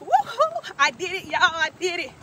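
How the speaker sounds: excited cheer. Real, not acted. Celebrating finishing her walk.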